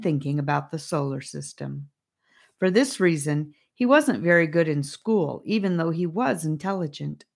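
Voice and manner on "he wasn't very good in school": plain reading voice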